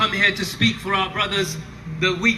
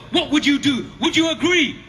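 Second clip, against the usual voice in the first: raised, rousing questions; roaring rallying speech; 5 semitones higher than usual. Acted. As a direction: push the crowd to answer. Rally speech call and response.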